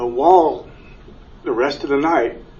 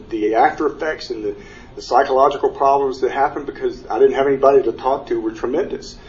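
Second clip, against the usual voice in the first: normal talking voice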